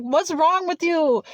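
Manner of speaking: a hint of a startled passerby asking; alarmed stranger. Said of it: "she's like".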